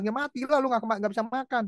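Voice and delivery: arguing with God. Retold or imitated. Man scoffing at the verse about birds, argumentative. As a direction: cap the argument in a lifted voice. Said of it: "berargumentasi pada waktu baca firman".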